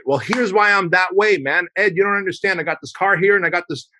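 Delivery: mock the guy who justifies everything; defensive excuses. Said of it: "we typically go into defending it".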